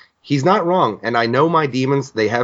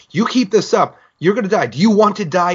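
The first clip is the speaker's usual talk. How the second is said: retold yell; 6 semitones higher than usual. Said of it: "he's yelling".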